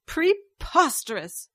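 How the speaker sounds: high, slow indignant snarl. A narrator's reading. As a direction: snarl it in outraged denial. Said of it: "she snarled".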